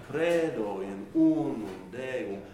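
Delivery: "credo in unum Deum" loud and drawn out; stretched Latin accent. Shown as a demonstration. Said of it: "that sense of high, light, stretched".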